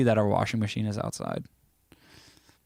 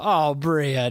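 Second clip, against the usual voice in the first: normal talking voice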